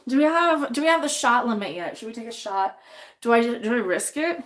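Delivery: ordinary talk, nothing marked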